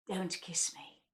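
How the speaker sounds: whispered final punchline. Acted. Ending the comic poem on a whispered punchline. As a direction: breathe the last line as a secret.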